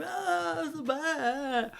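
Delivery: drawn-out, high-pitched mock wail; mock panic. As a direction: imitate a panicked runner, slow and very high. Imitating Brits running from bear.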